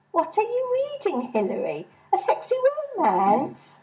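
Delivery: high, prying question; nosy Mary. Acted. Mary prying at Hilary's book in a high voice. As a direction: lean in and pry like a nosy friend.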